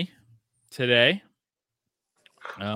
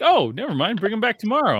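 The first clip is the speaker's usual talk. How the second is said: higher, louder mock-casual reversal; mock breezy school staff; 8 semitones higher, 5 dB louder, faster than usual. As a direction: toss it off like it's nothing. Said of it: "They're like".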